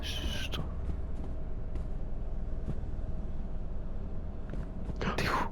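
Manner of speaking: unvoiced hushed whisper; whispering while hiding. Real, not acted. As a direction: whisper so nobody hears. Hiding from monster.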